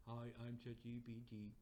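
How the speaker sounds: even, monotone assistant recital; robotic chatbot. Acted. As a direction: recite answers like an unfeeling machine. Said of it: "That's not how chat G P T talks".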